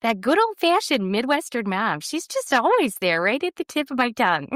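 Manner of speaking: high-pitched Midwestern mom voice; Midwestern mom accent. Shown as a demonstration. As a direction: play the old-fashioned mom with a Midwestern accent. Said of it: "you sounded exactly like one".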